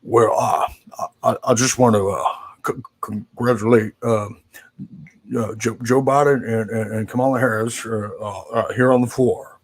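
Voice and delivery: a hint of a hesitant elderly Senate speech, pausing between words; loose, unrehearsed take on a halting Senate floor speech; Mitch McConnell. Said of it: "I'm gonna do my Mitch McConnell impression".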